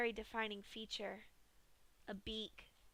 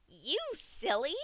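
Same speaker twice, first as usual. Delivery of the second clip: giggling; 5 semitones higher, 5 dB louder than usual. A narrator's reading. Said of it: "Pinkie giggled and responded".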